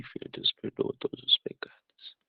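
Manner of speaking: soft unvoiced whisper from the mother. Mother whispering reassurance to her guilty son. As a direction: breathe it out as a hushed whisper.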